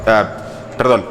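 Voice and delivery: usual speaking voice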